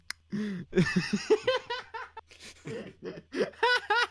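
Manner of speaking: long, high-pitched helpless laugh; sustained high giggling; real laughing fit. Real, not acted. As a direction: crack up and keep going. Cracking up at the boner joke.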